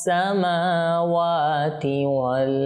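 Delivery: slow, melodic Quran chanting; solemn drawn-out tilawa; Quran recitation. Acted. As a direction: draw each word out in measured tajwid recitation. Recitation resumes.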